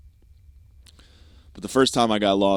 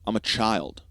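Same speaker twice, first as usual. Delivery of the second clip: normal talking voice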